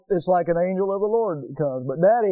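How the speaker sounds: ordinary talk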